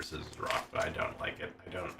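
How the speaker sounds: whispered under the breath; breathy whispered complaint; whispered aside. Real, not acted. Muttered aside.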